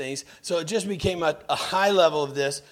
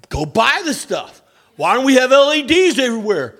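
put-on loud, high, eager young intern; exuberant intern; 9 semitones higher, 9 dB louder than usual. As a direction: play the overexcited rookie begging for gadgets. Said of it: "when I was an exuberant intern".

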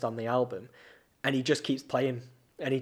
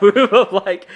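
laughing through the words, louder; 7 semitones higher, 15 dB louder, slower than usual. Real, not acted. Remembering being a naughty kid, laughing. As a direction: giggle it out.

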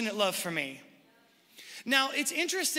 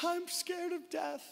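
put-on little girl voice; 7 semitones higher, 6 dB quieter, slower than usual. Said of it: "She's like".

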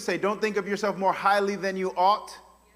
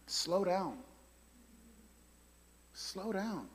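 much softer, slow plea; soft urging; 2 semitones lower, 14 dB quieter, slower than usual. Real, not acted. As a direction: ease them down gently. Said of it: "urges the listener".

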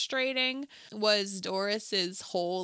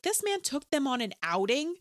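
mildly shocked, rising disbelief; incredulous; 4 semitones higher than usual. Retold or imitated. Reacting to a stranger taking the kids out, incredulous. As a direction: question it like you can't believe it.

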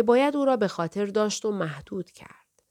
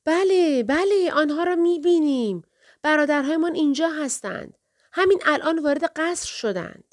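high, raised cries from the lookouts; excited shouting; 9 semitones higher, 3 dB louder than usual. A narrator's reading. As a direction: shout the sighting down, pitched right up. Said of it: "خواهرها فریاد زدند".

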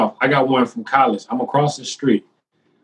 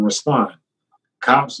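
ordinary talk

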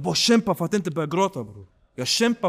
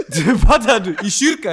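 laughing; 5 semitones higher, 12 dB louder than usual. Real, not acted. Telling the host he saw Sebbe in church, loud laughing disbelief. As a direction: laugh it out, stunned and amused.

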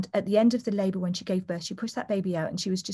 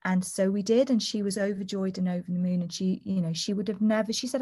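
ordinary talk

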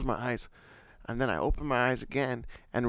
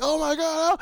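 retold screaming; 12 semitones higher than usual. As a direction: mimic panicking kids in a car. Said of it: "we start screaming".

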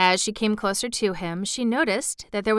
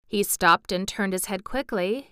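ordinary delivery

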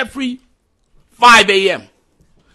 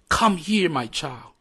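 softer, lower, gentle call; God calling gently in a still small voice; 6 semitones lower, 9 dB quieter than usual. Retold or imitated. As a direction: speak softly like a tender father. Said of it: "God is speaking in a still small voice".

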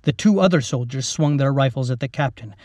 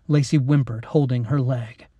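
ordinary delivery, nothing marked

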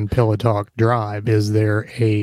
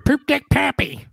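Popeye voice; 14 semitones higher than usual. Pretend. Popeye cartoon voice.

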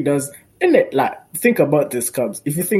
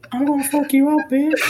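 mock pimp threat, higher and laughing; hammed-up pimp, cracking up; 11 semitones higher than usual. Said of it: "I'm gonna lower my voice right now and sound like a pimp".

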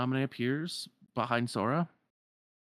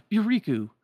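high, loud burst of realisation; sudden recognition; 11 semitones higher, 9 dB louder than usual. Acted. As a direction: exclaim it as it suddenly dawns on you. Sudden recognition after a pause.

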